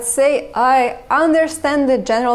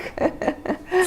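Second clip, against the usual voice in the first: quick laugh; 6 semitones higher, 6 dB quieter than usual. Real, not acted. Laughs after tongue twister.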